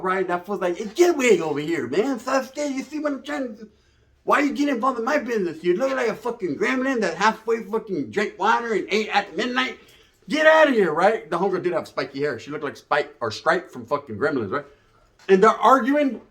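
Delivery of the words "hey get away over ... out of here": higher, heated vato rant; angry vato. Retold or imitated. Vato tells Lucy to stay out of his business, heated and high. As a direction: chew her out like a furious vato.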